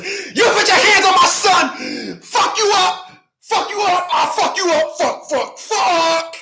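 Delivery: high, hysterical mock shouting; fake tough person. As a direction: screech like a blustering phony tough. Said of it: "a sloppy ass, fake ass, tough person".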